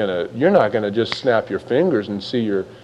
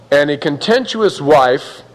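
normal talking voice

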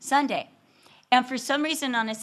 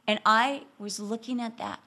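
ordinary talk, nothing marked

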